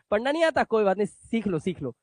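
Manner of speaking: patronizing voice, fading to a murmur; mock the know-it-all who corrects you; condescending listener. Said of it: "सामने वाला कहता है".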